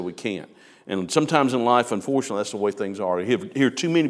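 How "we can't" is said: much softer, lower "We can't."; defeated. Retold or imitated. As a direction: let "We can't." sink in hopeless defeat. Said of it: "the ten that said".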